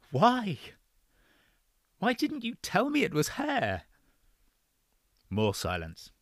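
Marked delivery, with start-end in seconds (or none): 0.1-3.8 higher, urgent pleading question; demanding. Acted. Son to father on phone, urgent demand. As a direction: press him for the truth. Evan demands answers, high voice.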